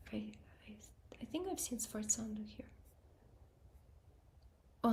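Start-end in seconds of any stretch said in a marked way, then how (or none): 0.1-2.7 whispered to self. Real, not acted. Thinking aloud, whispered.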